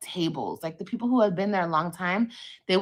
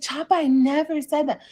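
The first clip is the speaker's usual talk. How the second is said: higher, louder mock protest; retold denial, pitched up; indignant denial; 7 semitones higher, 5 dB louder than usual. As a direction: act out pleading innocence to an accuser. Acted denial in retold quarrel.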